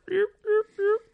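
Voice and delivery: high, quick yip yip like a hen turkey call; imitated turkey yips. Hunter calling to the toms with light yips. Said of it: "gave 'em a couple more little fucking yip".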